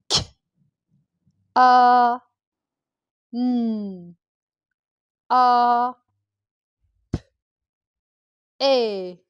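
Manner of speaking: K, A, N, A, P, E each isolated; bare K and P, long held A, N, A, E; sound by sound. Shown as a demonstration. Teacher counting sounds on fingers, sound by sound. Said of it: "je vais compter cette fois les sons".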